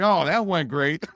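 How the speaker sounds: sarcastic verdict after the loadmaster fails. Retold or imitated. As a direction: drip with sarcasm, drawn out. Sarcasm after failed order.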